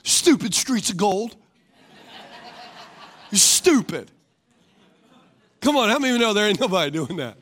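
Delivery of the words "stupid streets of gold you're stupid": slow, sulky mock complaint; grumpy heaven resident. Preacher mocking an angry soul in heaven, sulky grumble. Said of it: "There's nobody going".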